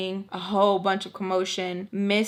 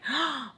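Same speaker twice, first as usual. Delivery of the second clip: high, soft intake of breath; mock shocked gasp; 6 semitones higher, 7 dB quieter than usual. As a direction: gasp as if scandalized. Imitates her shocked reaction.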